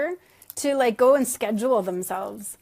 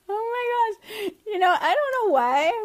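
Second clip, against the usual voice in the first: helpless laughter; 10 semitones higher than usual. Real, not acted. Laughing at own slip.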